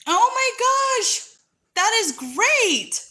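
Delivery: high, slow, delighted gasp of joy; overjoyed by the news; thrilled. Real, not acted. Hears news of second baby.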